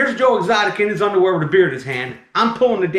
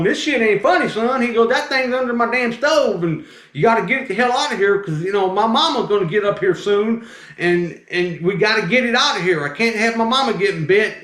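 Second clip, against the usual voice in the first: high, agitated southern man; worked-up southern impression, pitched up; southern accent; 6 semitones higher than usual. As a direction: imitate an indignant southern customer. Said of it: "he's talking with his southern accent".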